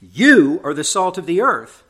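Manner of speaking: "You" punched high, loud and drawn out; slow the verse, hit "You" hard; emphatic stress on You. Retold or imitated. Preacher demonstrating how Jesus stresses it, emphatic You. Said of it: "He's stressing the you".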